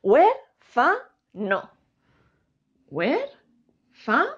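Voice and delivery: "Huérfano" very slowly split into syllables; drawn-out, syllable-by-syllable "Huérfano". Shown as a demonstration. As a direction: break "Huérfano" into three separate beats, pausing between. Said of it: "lo separamos en sílabas".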